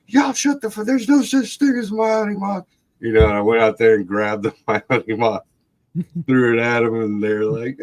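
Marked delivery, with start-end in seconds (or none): 0.1-2.7 high-pitched angry mock yell; pissed off. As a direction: re-enact an exasperated drunken telling-off. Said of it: "pissed off at them and my cousin".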